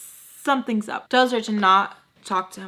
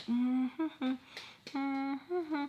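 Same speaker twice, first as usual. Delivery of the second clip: soft, much quieter awkward hum; awkward mm-hmm; 6 semitones higher, 10 dB quieter than usual. Retold or imitated. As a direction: hum along, trying to stay unnoticed. Sitting awkwardly on the couch.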